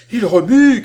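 loud, high-pitched joyful shout; cry of wild joy. A narrator's reading. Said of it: "cria-t-elle, folle qualité de joie". (cri aigu, fou de joie)

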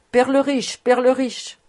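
loud, high call across the inn; ringing call. A narrator's reading. Banging the table, calls the innkeeper loudly. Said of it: "il s'écria d'une voix retentissante".

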